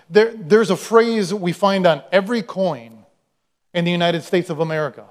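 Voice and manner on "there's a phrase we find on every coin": ordinary talk, nothing marked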